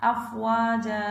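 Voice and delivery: slow, drawn-out tajweed chant, phrase by phrase; Quran recitation. Acted. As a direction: chant each phrase slowly for the child to repeat. Teacher recites Surah Nasr for repetition.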